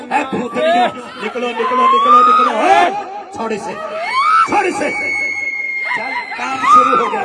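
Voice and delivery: very high, loud screaming commands; screamed rebuke. Real, not acted. Preacher screaming at the female spirits to leave. Shouting at demons.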